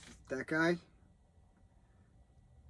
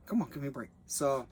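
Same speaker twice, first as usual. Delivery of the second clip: ordinary delivery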